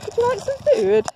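high, loud sing-song voice for the animals; pet talk. Real, not acted. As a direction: coo it up high to the alpaca. Offering food to alpacas.

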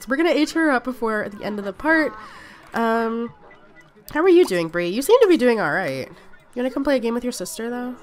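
ordinary talk

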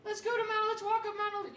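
high mock of his girlfriend's suggestion. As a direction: pitch it up like a nagging girlfriend. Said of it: "she go".

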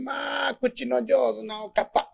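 coy woman. A narrator's reading. Flattered fox-woman coyly replies.

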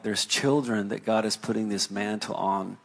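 usual speaking voice

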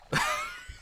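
short high, voiceless giggle; breathy laugh. Real, not acted. Game master losing it over own accent, quick laugh. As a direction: crack up briefly at yourself.

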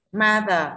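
"Mother" with MO hit loud, THER falling; stress on first syllable. Shown as a demonstration. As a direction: punch MO in "Mother". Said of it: "rơi vào âm tiết thứ nhất là ma". (nhấn âm tiết đầu "Mother")

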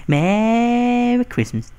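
loud, high, drawn-out mock cheer; exaggerated holiday call, stretched and high; singsong greeting. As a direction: swoop up and hold it long. Repeats gleeful greeting after stealing.

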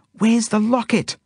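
very high, drawn-out cry; alarmed shout. A narrator's reading. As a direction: shout the question at your friend. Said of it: "I shout at Zelda".

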